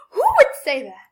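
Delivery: ordinary talk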